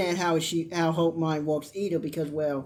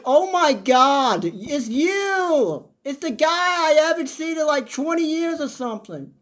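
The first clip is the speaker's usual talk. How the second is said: slow, higher gushing voice; mock gushing Hope; 6 semitones higher, 3 dB louder, slower than usual. Hope overjoyed to see R J, gushing. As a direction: gush like meeting a long-lost brother. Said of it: "She was like".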